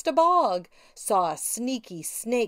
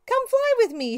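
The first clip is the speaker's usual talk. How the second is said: high, bright call to a friend; 13 semitones higher, 3 dB louder than usual. A narrator's reading. Witch calling another witch to fly along. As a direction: sing it out high and loud. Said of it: "she called".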